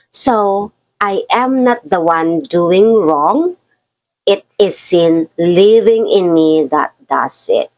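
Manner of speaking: slow, deliberate Bible reading; scripture reading. Acted. Preacher reads the verse to the congregation, slow scripture reading. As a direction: read each phrase slowly to let it land.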